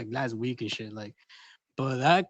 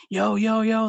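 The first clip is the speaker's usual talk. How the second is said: louder, higher hype calls; mock excited shouting; 7 semitones higher, 5 dB louder, slower than usual. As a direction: hype it up like a friend yelling. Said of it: "Hershey's all like".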